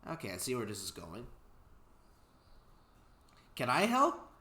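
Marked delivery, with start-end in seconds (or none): 3.5-4.3 high, bright little engine asking, louder; small eager engine. Acted. Narrator voicing Thomas asking to help, high and eager. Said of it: "asked Thomas".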